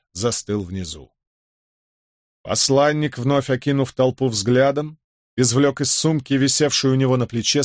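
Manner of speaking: ordinary delivery, nothing marked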